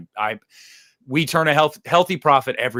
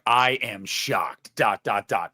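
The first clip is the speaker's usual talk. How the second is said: slow, deadpan; 5 semitones lower, slower than usual. Pretend. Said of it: "it just needs to be like".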